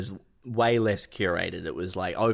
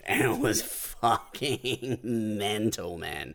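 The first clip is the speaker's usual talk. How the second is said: normal talking voice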